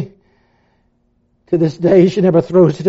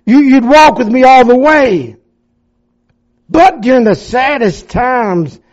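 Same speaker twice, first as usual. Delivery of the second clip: high, loud pleading prayer; raised, aching plea to the Lord; anguished plea; 5 semitones higher, 4 dB louder than usual. Retold or imitated. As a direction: cry out to God, hurt and lost.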